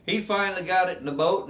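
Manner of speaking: usual speaking voice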